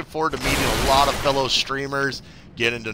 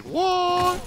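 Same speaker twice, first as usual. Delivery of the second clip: shocked high what. Retold or imitated. Retelling shocked reaction.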